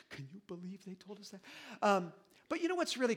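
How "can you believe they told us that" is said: much softer, hushed disbelief; private hushed disbelief. Retold or imitated. Parents alone in their room, hushed disbelief. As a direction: whisper it behind a closed door. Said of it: "Cindy and I go in our rooms and go".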